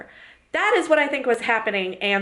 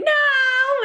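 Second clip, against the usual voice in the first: put-on high, bratty little-kid "No"; imitating a stubborn toddler; toddler refusing; 3 dB louder than usual. Daughter refusing the potty, high kiddy voice. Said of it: "And Elizabeth saying".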